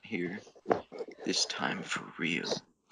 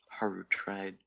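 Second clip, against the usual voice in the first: ordinary delivery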